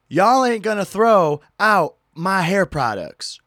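mock the lady's loud protest; loud yelling. Lady protesting to TSA, slow loud yell. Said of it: "really loud".

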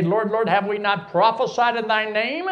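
ordinary delivery

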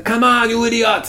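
high-pitched movie-prisoner yell; mock urgent shout. Acting out prisoners shouting at the early runner, mock urgent shout. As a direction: yell it in hammed-up exasperation.

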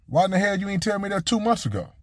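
mock complaint to coach for the late tip; exasperated. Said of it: "I said".

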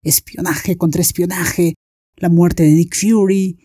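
usual speaking voice

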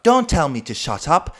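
high, loud posh British retort; shrill posh English. Retold or imitated. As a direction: mimic an affronted posh Englishman. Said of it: "puhuu silleen tosi semmoisella kimeellä englannilla".

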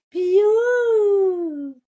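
long, very high, loud chick cry; chick peep. Acted. Poussine répond. (le poussin crie piou, très aigu)

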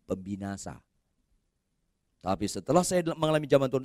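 ordinary delivery

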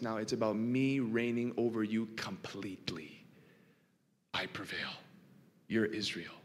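God speaking softly to Jacob; intimate whisper. Acted.

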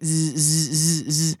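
low repeated buzz noise; short droning buzzes in a row; phone buzzing. Retold or imitated. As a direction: imitate a vibrating phone. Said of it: "And it's usually when it goes".

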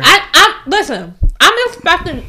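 talking around ice cubes; words muffled by ice in mouth. Real, not acted. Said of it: "shit, I got ice in my mouth".

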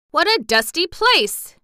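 high, loud complaining cry; cried out. A narrator's reading. Gallipoot choking in the tunnel, loud high cry. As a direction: cry out in disgust at the dust. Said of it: "he cried".

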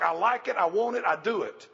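ordinary talk, nothing marked

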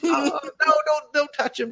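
very high mock-anxious pleading; protective dad. Dad shields the cat from mom, high flustered pleading. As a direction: fuss over it in a squeaky high voice. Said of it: "And he's just like".

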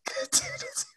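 whispered punchline. Real, not acted. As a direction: drop to a breathy whisper.

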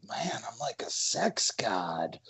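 slow, breathy private boast; whisper. Retold or imitated. Recalling his own smug thought.

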